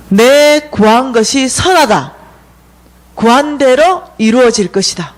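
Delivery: slow, weighty pronouncement; dream messengers' verdict. Retold or imitated. Dream visitors approve her request, slow solemn verdict. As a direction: pronounce it in measured, stressed phrases. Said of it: "이러는 거예요".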